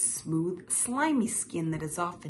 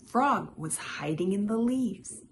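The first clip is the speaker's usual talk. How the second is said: plain reading voice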